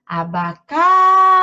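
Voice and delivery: ABACATE with a loud, long CA; "a-ba-caaa-te", stretched and high on CA; elongated stressed syllable. Shown as a demonstration. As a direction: call out abacate, holding the CA. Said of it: "A sílaba mais alongada de abacate é o K".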